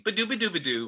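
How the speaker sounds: sung Caribbean guitar lick; scatted guitar riff. Retold or imitated. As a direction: scat the riff bouncy. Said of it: "Caribbean kind of thing".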